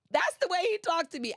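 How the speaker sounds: tickled by her own story; laughing. Real, not acted. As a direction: laugh through it, fast and high. Laughs after quoting God.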